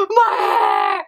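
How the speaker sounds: high, loud mock sobbing cry; mock wailing. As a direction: wail it like a drama queen. Acting out her teen crying.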